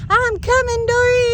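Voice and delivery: high, loud shout to the creek; frantic call. Retold or imitated. Owner shouting to her dog in the creek. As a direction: yell out as you run to save her.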